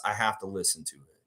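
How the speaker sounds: usual speaking voice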